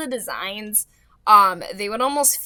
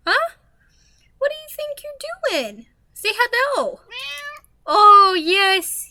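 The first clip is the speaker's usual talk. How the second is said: high pet talk; 11 semitones higher, 5 dB louder than usual. Real, not acted. Asking the cat on her lap what it's doing, high pet voice.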